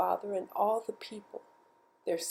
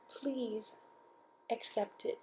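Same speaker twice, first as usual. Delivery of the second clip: softer, breathy whispered plea; 4 semitones higher, 11 dB quieter, slower than usual. Acted. Girl offers doll to the spirit, whispered plea. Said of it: "she who was alone said".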